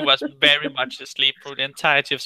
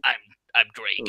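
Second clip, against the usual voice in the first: [name] voice; 8 semitones lower, 5 dB quieter than usual. Pretend. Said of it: "what's his voice".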